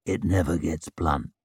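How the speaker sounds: low, broken whispery voice; broken whisper. A narrator's reading. Cool Shivers answering talk of sharpened swords, broken whisper. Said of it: "in that broken whisper of his".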